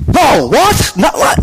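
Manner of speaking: high, startled cry of disbelief; Fred blurting out in alarmed shock; shocked protest. Acted. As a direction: recoil in outrage at the question.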